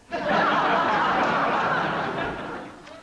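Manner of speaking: a real cackle of laughter; loud high laugh. Real, not acted. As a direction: burst of high breathy laughter. Host's big laugh after joke.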